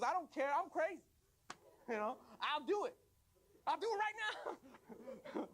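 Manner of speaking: high, hammed-up reckless threat; crazed defiance. Tongue daring the body parts, crazed defiance.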